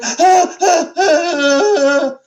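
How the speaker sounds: high, drawn-out scared gasps with a laugh; mock panicked gasps. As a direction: ham up a frightened shriek, mocking. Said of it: "most people are like".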